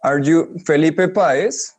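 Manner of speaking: ordinary delivery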